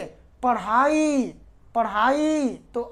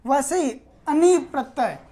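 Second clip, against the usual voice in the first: usual speaking voice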